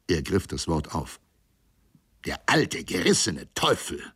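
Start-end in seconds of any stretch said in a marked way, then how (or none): none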